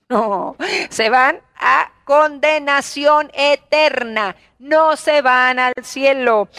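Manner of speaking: drawn-out, weighty warning; slow preacher's pronouncement; slow emphatic denial. Real, not acted. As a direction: stretch each word out slowly, preaching doom. Preacher answers own question emphatically.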